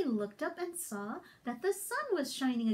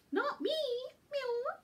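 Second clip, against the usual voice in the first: cat voice; 9 semitones higher than usual. Acted.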